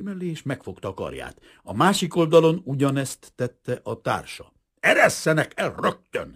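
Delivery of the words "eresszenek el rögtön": higher, louder, forceful hiss; hissed demand. A narrator's reading. Kazimír to the detectives gripping his arms, hissing angrily. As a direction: hiss out an angry order. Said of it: "sziszegte Kazimír".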